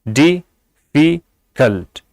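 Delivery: Difficult broken in three, di, fi, kalt; difficult in three separate beats; split into syllables. Shown as a demonstration. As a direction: say difficult slowly with a gap after each syllable. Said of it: "इसमें तीन syllable हैं".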